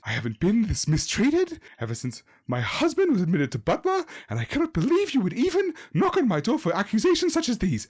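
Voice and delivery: a mother stung by the accusation, pitch rising; indignant old woman. A narrator's reading. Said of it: "looks indignant".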